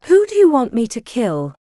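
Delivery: pressing. A narrator's reading. Rowan presses the young woman to name the target, insistent. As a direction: press her hard for the name. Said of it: "he pressed".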